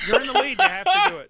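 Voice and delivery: laughing shout. Real, not acted. Laughing insistence during the game.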